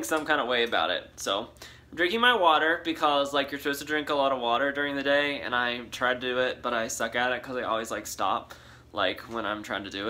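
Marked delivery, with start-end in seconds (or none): none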